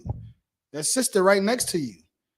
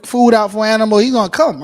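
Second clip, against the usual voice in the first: loud, rising emphasis; 4 semitones higher, 10 dB louder, faster than usual. Real, not acted. Preacher raising voice.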